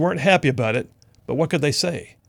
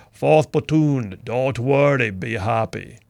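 slow, accented imitation of a small man's big voice; deep-voiced Bosco impression; much slower than usual. Bosco answering his platoon with his catchphrase, imitated deep voice. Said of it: "He had a very deep voice".

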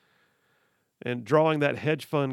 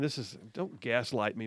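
ordinary delivery

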